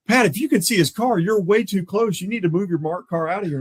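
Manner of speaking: high-pitched retold warning; urgent scolding. Partner warns officer his marked car is close, urgent scolding. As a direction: imitate a partner telling someone off.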